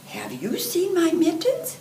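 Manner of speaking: put-on high, light child's voice; little boy Pika asking. A narrator's reading. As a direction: ask it like a small boy hunting his mittens. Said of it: "Pika asks".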